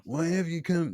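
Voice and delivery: high, strained Godfather attempt; a failed try at the Don; Brando impression. Shown as a demonstration. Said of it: "Yeah, I can't do it".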